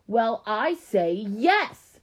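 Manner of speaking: loud, high, very slow retort; defiant overruling. A narrator's reading. Keisha overrules her little sister, loud and defiant. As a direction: push each word out hard, up to a big YES.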